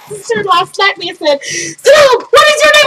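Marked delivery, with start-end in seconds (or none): 1.4-2.9 loud, high, exasperated demand; imitated scolding. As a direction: shout sense into a dazed relative. Sister-in-law slaps her and demands name.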